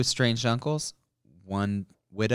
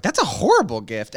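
mock disgusted; 4 semitones higher, 6 dB louder than usual. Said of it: "I was like".

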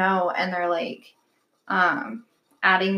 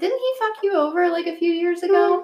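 much higher, incredulous and loaded; pointed question; 9 semitones higher than usual. Retold or imitated. To a friend about a creep, pointed incredulous question. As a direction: ask it knowing the answer. Retells messaging her friend.

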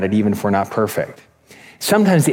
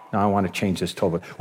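softer, lower timid customer; meek reply; 5 semitones lower, 7 dB quieter than usual. Retold or imitated. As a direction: imitate a cowed customer answering back. Meek customer answering yelling clerk.

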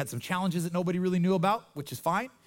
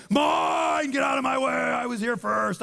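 greedy selfish shout; 6 semitones higher, slower than usual. Retold or imitated. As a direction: bark it like a greedy child shoving to the front. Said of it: "there's part of me that like".

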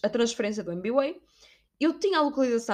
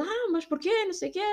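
higher, lightly put-on voice for the boy; lightly voiced boy; 8 semitones higher, 4 dB quieter than usual. Quoting the boy from the metro, lightly put-on. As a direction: toss off the boy's question. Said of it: "E ele".

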